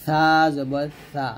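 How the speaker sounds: slow, light "সা জবর সা", tongue tip on the upper front teeth; slow letter demo. Shown as a demonstration. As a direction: say a thin "সা" slowly. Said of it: "পাতলা করে লাগিয়ে সা". (পাতলা করে ধীরে "সা জবর সা")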